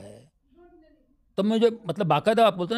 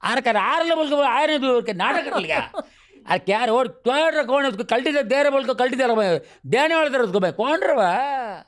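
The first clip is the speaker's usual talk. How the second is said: Dharavi street lingo; 6 semitones higher than usual. Retold or imitated. Locals greeting each other in Dharavi street slang, lively. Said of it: "Dharavi का same language".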